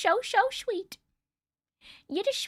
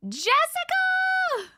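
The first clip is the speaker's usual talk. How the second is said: excited greeting; much slower than usual. Real, not acted. Greeting a viewer on live.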